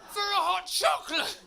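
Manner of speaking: high, loud laughing rant; exasperated comic outburst; ranting shout. Acted.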